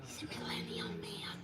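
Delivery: low whisper, almost no voice; softly whispered explanation; hushed aside. Real, not acted. Confiding aside about a delicate cat.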